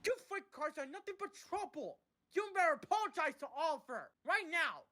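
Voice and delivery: high, sharp telling-off; angry scolding. Acted. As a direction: snap at the naughty freight cars. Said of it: "very angry and firm".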